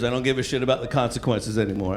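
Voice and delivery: normal talking voice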